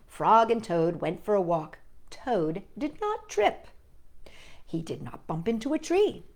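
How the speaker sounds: ordinary delivery, nothing marked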